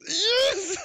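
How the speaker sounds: high, exultant whoop; jubilant cry. Real, not acted. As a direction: burst out in triumph. Celebrating the win once more.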